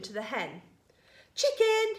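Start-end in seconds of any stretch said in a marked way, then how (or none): 1.3-1.9 high, loud singsong call; coaxing call. A narrator's reading. George luring the hen to the spoon, coaxing call.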